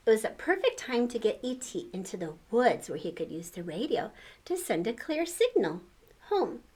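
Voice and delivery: ordinary delivery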